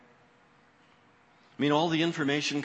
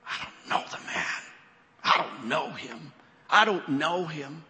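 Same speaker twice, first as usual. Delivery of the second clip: vehement denial. Acted. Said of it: "vehemently called out".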